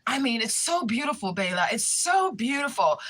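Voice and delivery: high, stretched-out imitated admiration; infatuated gushing. Smitten man praises her hair, infatuated gushing. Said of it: "He was, like, infatuated".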